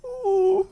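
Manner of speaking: mock wail as the adrenaline drains; adrenaline-dump wail.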